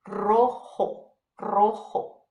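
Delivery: rolled R starting "Rojo"; strong R. Shown as a demonstration. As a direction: trill the first R of Rojo strongly. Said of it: "if it's the first letter".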